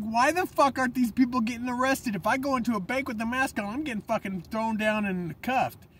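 fired-up retold anger; angry outburst. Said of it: "And I'm like".

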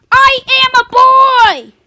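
high frustrated shout; shouted insistence. Acted. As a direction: yell it back in frustration. Argument over being a girl.

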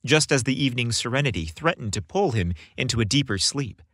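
ordinary delivery, nothing marked

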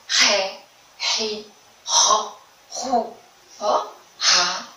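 isolated syllables with a throaty R, spaced out; strong R syllables. Shown as a demonstration. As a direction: demonstrate each R syllable separately for children. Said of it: "vamos ver as sílabas em que ele aparece". (sílabas com R forte, bem separadas)

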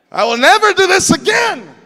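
high, loud mock-desperate shout; hammed-up cry of resolve; shouted vow. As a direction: yell it like a dramatic oath. Repeats the acted vow after failing.

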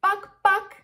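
two high, short clucks; short cluck-cluck for a child to copy; chicken clucks. Shown as a demonstration. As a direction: imitate a hen, twice. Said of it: "say it two times".

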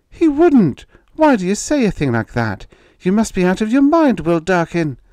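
high, loud wifely outburst; outraged. A narrator's reading. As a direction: challenge the husband in alarm. Wife quarrels to protect children.

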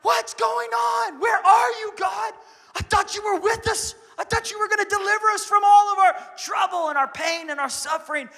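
high, loud panicked shouting; panicked disciples. Retold or imitated. Disciples in the storm shout at God in high panic. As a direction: cry out like the boat is sinking. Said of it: "And they're like".

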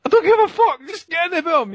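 very high excited outburst; retold yell. Answering his wife during the fight with a high excited yell. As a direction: shout it back at her, worked up. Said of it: "I was like".

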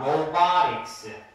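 로바릭스 slow with stretched vowels; pronunciation demo. Shown as a demonstration. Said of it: "발음이 이제 잘 들어봐". ('로바릭스'를 천천히 늘여서 또박또박)

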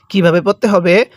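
ordinary talk

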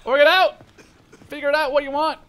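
loud, high exasperated yelling; frustrated high yell at teammates. Real, not acted. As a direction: shout orders at a dithering squad.

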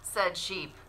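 put-on lively storybook narration for kids; animated picture-book read-aloud; reading to children. A narrator's reading. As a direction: read it like story time at the library.

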